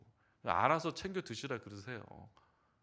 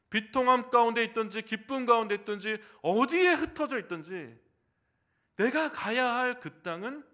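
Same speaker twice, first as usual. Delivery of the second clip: loud, high, rising sermon cadence; full-force preaching; 8 semitones higher, 12 dB louder than usual. Acted. Preacher rallying the congregation toward the promised land, full force. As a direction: preach it out with mounting fervour.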